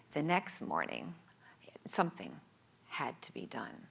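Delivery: dramatic whispered build-up; ominous whisper. A narrator's reading. Setting up her big change, whispered with suspense.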